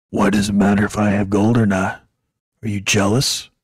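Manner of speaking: low, loud mock voice of an opponent; loud, deep-voiced impression of an arguer; imitated confrontational man. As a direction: challenge him in a mock deep voice. Imitating the man in his face.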